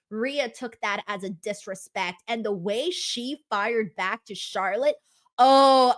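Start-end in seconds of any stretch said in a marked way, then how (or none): 5.4-5.9 excited oh. Real, not acted. Thrilled by Rhea's comeback.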